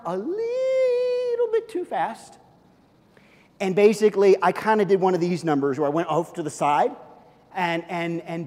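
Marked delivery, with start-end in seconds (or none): 0.1-2.4 high, slow mock cry; hammed-up high whimper; mock wail. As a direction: wail it like a kid crashing.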